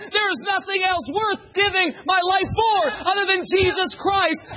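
high, loud, building to a sustained shout; preaching at full force. Real, not acted. Preacher telling how God became real to him, impassioned shouted preaching. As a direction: drive each phrase home at a fervent holler.

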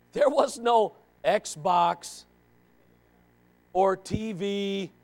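very slow, spelled out word by word; drawn-out, deliberate listing; slow emphasis. Real, not acted. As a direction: lay out the poverty pointedly. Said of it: "stressing how poor they were".

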